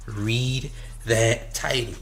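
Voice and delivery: "Read that title" drawn out slowly and softly; slow, deliberate repeat. Real, not acted. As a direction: spell out "Read that title" word by word, quietly. Same line repeated slower for emphasis.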